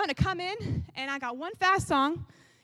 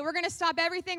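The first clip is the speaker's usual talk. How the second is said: normal talking voice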